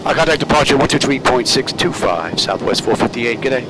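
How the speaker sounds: crisp radio readback; pilot radio call. Real, not acted. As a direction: read it back like an airline pilot.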